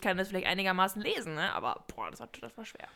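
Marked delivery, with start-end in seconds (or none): 1.5-2.9 low groan then a whispered sigh; breathy exasperation. Real, not acted. Recalling the Bavarian song sheet, whispered groan. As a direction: let it out as a whisper. Reading Bavarian was hard.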